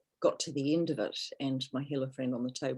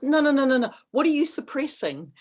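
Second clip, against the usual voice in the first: imitated insistent rising protest, much louder and higher; playful mock insistence, pitched up; 11 semitones higher, 8 dB louder than usual. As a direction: mimic the friend cutting her off. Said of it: "She goes".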